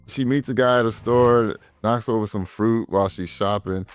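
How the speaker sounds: ordinary delivery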